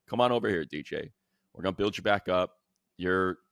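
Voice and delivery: ordinary talk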